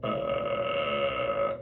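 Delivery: low creaky rattle; fry it without air; glottal fry. Shown as a demonstration. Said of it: "what we call a glottal fry".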